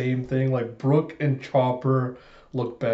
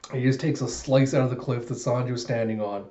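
ordinary talk, nothing marked

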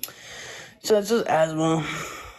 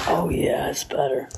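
ordinary talk, nothing marked

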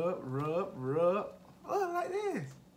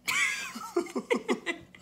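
high laughing squeal. Real, not acted. Laughing fit.

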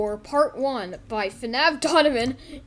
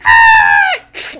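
high, loud scream; falling scream; 11 semitones higher, 15 dB louder than usual. Acted. Figure that fell off, loud high scream.

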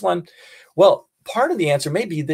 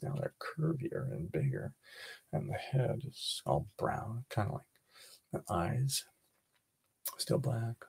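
whispering; 2 semitones lower, 18 dB quieter than usual. Acted. Kids whisper observations to camera.

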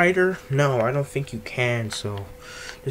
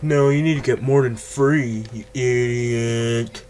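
mocking the game; 3 dB louder than usual. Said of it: "the fucking game will probably be like".

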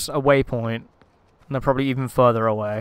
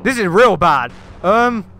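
scared horror game streamer; 4 semitones higher, 6 dB louder, slower than usual. Real, not acted. Finds the friends missing.

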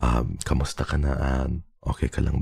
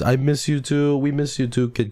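cutesy coo; 10 semitones higher than usual. Acted. Cooing back to chat.

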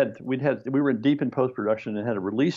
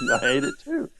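high, laughing admission; laughing retort. Retold or imitated. Answering Francis about the film, high laughing. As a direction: squeak it out through a laugh.

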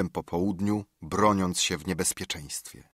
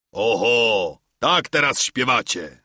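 calling out; 2 semitones higher, 6 dB louder, slower than usual. Acted. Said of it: "zawołał kucharz".

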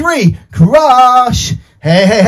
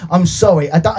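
ordinary talk, nothing marked

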